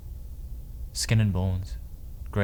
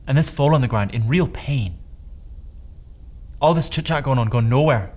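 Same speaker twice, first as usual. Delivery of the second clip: agitated; 5 semitones higher, 4 dB louder than usual. Acted. Telling Dom of the foal in pain as boys dither, agitated. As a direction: voice raised higher and louder in urgency.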